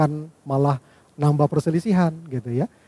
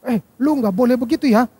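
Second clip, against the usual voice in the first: higher, louder sharp scolding; teguran keras; 8 semitones higher, 5 dB louder than usual. Shown as a demonstration. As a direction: tell a friend off with a raised, high voice. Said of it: "dengan kalimat yang sama nih". (menegur teman dengan nada tinggi dan keras)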